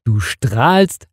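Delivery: usual speaking voice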